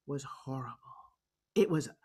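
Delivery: very soft, low and slow, nearly whispered; hushed. Real, not acted. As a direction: let the voice sink almost to a whisper. Recalls antisemitism, voice drops away.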